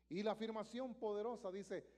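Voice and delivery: ordinary talk, nothing marked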